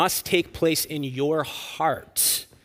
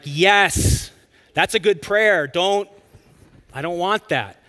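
higher, louder burst of agreement; emphatic agreement; 5 semitones higher, 7 dB louder, slower than usual. Retold or imitated. Preacher answers people praying he be like David, emphatically. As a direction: act out an emphatic reply. Acted eager reply to a prayer.

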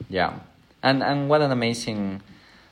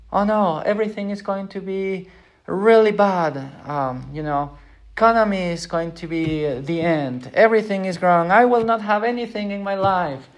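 higher, louder anxious wailing; mock panic; 8 semitones higher, 4 dB louder than usual. Preacher voicing adults fearing economic doom, mock panic. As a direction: ham up an adult sure that life is ruined. Worried adult voiced in sermon.

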